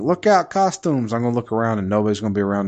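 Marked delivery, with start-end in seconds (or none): 0.0-1.1 high, slow mock henchman warning; mobster impression. Recalling a game line in old age, high mock mobster cry. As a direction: call it out like a hammy game mobster. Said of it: "I'm gonna think".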